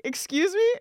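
losing patience with the ignoring clerk; exasperated excuse me. Retold or imitated. Ignored again, escalating.

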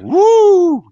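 high, loud siren whoop; siren imitation. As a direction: make the police siren sound. Sirens as cops arrive.

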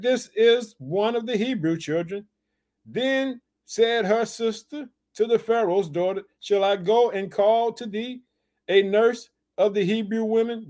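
lifted, even Bible reading; scripture reading. Acted. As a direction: read the verse to the flock. Preacher reads the scripture.

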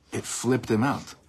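normal talking voice

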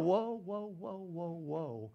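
preacher stopping a wrong idea, fading; halting whoa. Real, not acted. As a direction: rein the crowd in, trailing off. Stopping the listener short.

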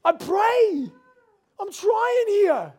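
high, loud and slow, near breaking; anguished plea. Retold or imitated. Pastor pleading with God on the beach, high strained anguish. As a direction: stretch each word out in strained desperation. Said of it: "I'm like".

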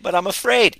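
high, loud whimper of a scared client; mock scared client. Coach mocks a viewer who hesitates to attend the workshop, high mock fear. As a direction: play up the fearful excuse. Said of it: "you're gonna be afraid".

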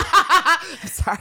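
bursting into laughter through the words; laughing apology. Real, not acted. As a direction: apologise while still laughing hard. Cracking up at own joke.

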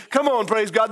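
ordinary talk

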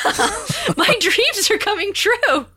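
high, laughing squeal of joy; excited squeal. Retold or imitated. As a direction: squeal like a thrilled fan. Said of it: "screaming at my phone".

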